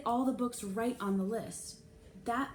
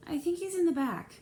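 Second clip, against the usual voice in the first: much higher, louder light voice; librarian voice; 9 semitones higher, 4 dB louder than usual. A narrator's reading. Mrs Jones tells Mrs Spencer where Trey is, sweetly. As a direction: answer sweetly.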